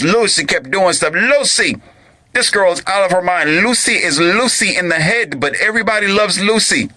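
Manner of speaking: high-pitched exasperated rant; mock fed-up outburst. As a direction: vent like a man mad at his wife. Venting as a man mad at Lucy.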